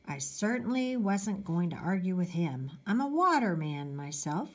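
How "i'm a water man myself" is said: usual speaking voice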